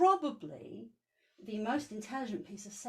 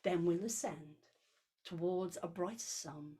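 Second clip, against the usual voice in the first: poem reading; 2 semitones lower than usual. Acted.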